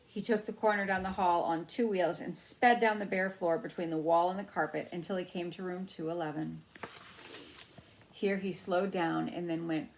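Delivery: plain reading voice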